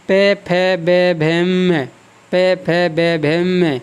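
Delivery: का, खा, गा, घा, ण recited in a measured row; syllable drill. Shown as a demonstration. Teacher reciting the ट-row to show the nasal at its end. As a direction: chant the row slowly, landing on ण. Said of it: "इसी प्रकार से".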